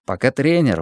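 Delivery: plain reading voice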